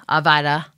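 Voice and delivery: Avita said uh-VY-duh; "Avita" with VY as its middle syllable; alternative pronunciation. Shown as a demonstration. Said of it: "however you say it".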